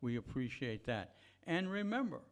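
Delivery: usual speaking voice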